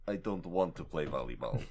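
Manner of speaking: thick mock Russian accent. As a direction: Hugo spitting sand, Russian accent. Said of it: "No, he's Russian now".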